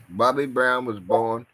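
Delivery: ordinary delivery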